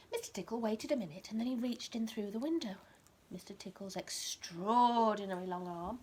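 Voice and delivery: lively bedtime story reading, rising on the key words; reading to a child. A narrator's reading. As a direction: read it like a picture book to a toddler.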